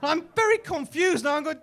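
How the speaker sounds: ordinary delivery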